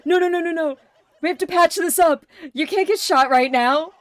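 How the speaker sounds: loud, high, rapid mock pleading; frantic protest, hammed-up and loud; acting out panicked protest. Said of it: "And he's like".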